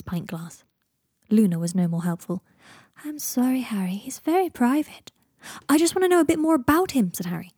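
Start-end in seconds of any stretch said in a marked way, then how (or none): 3.0-5.1 high, airy, slowing apology; gentle Luna. A narrator's reading. Luna turning down Harry's questions, gently apologetic. 5.7-7.1 high, loud, insistent plea; pleading protest. A narrator's reading. Harry pressing Luna for information, pleading.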